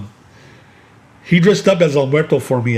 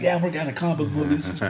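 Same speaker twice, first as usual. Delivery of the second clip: mild excited imitation; 2 semitones higher, 6 dB quieter, faster than usual. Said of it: "he was really excited".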